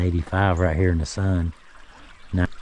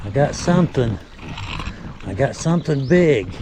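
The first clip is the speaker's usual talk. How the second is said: excited; 6 semitones higher than usual. Real, not acted. Fish strikes on the line.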